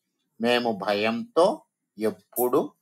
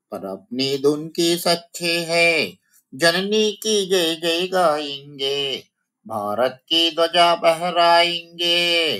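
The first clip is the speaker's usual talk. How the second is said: raised, sing-song verse; poem recitation for children; 6 semitones higher than usual. Shown as a demonstration. Teacher reciting the poem to the children, sing-song. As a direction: chant it to the children.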